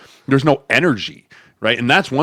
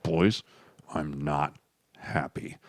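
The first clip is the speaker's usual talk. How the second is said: starting low and fading to a whisper; a stern coach going cold and soft; quiet menace; 7 semitones lower, 10 dB quieter, much slower than usual. Retold or imitated. Coach addressing team after practice.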